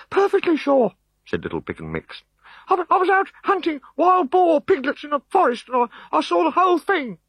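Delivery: put-on high, urgent boy's voice; a small boy blurting out bad news; high-pitched excited kid; breathless young boy. A narrator's reading. Boy Pick and Mix voiced.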